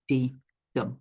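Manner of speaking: unstressed "dee", then a firm "dum"; stress on DUM. Shown as a demonstration. Teacher showing students an iamb, stress on "dum". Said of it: "your stress is on the second syllable".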